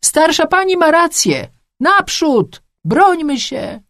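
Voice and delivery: very high, urgent cry; shrieked call to arms; rallying shout. A narrator's reading. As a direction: shout the crowd into action. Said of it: "i krzyknęła".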